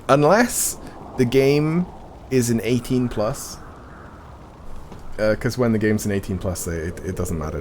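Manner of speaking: ordinary talk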